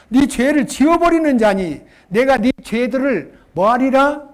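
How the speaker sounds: each word drawn out, loud and weighty; solemn proclamation; slow emphatic scripture reading. Acted. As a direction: proclaim it like holy writ.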